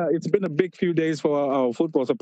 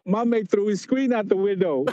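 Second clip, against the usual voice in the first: usual speaking voice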